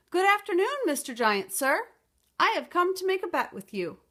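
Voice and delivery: high, loud and bold; a little girl puffing herself up brave; loudest, bravest voice. Acted. Little Beatrice calls up to the giant, loud and brave. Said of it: "said in her loudest, bravest voice".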